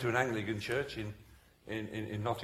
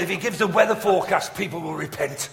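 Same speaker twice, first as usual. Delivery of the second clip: loud, higher retold complaint; mock exasperation; 4 semitones higher, 9 dB louder than usual. Theologian brother complaining about preacher, loud mock exasperation. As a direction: protest loudly at how easy he has it.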